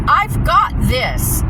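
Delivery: slow, high, emphatic retold retort; reenacted firm reassurance; exasperated insistence. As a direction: shut down the panic, stretching each word. Shutting down panicking Dad.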